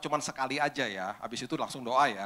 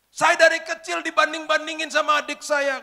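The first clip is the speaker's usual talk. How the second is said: crying; 13 semitones higher, 9 dB louder than usual. Retold or imitated. Girl suddenly breaks down about her father, crying loudly. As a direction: sob out the grudge. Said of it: "Sambil nangis-nangis".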